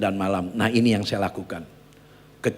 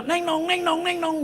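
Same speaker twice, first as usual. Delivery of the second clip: high sing-song ding-dong; imitated doorbell. Someone at the door ringing the house bell, high imitated chime. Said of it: "ada bu-, suara ... Bel rumah".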